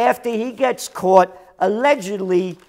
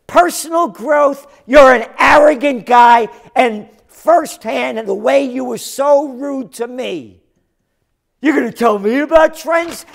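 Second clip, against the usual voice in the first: shouted, higher, mocking outrage; loud contemptuous rant; furious scorn; 7 semitones higher, 8 dB louder than usual. Real, not acted. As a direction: blast him for his arrogance. Scorning the quoted statement.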